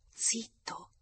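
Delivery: whispered repeat of the hush. A narrator's reading. She hushes him a second time, whispering. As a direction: breathe it out as a whisper. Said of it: "ripeté lei".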